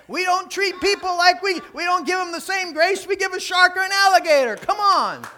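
high, loud preacher's shout; impassioned preaching. Real, not acted. Preacher challenging the congregation on grace, impassioned shout. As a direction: drive it home at full volume.

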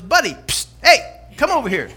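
lightly voiced parrot, loud and high; beckoning parrot. Parrot calling the returning customer over, beckoning. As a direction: hail a customer with a sharp psst. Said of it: "and that parrot's still there".

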